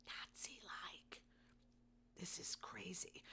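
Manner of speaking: voiceless, slow whisper; appalled whisper. Real, not acted. Podcaster on government demanding voter data, appalled whisper. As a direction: drop right down to a whisper.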